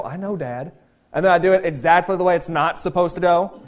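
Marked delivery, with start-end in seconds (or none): 0.0-0.7 a soft brush-off I know, Dad; dismissive teen. Retold or imitated. Son brushing off his dad, soft and dismissive. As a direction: sigh it off as a bored teen. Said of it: "I'd go".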